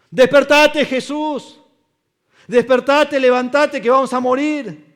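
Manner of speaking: high, loud terrified cry; desperate shout. Acted. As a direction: yell over the storm for help. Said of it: "gritando en la tormenta". (grito desesperado, agudo y fuerte)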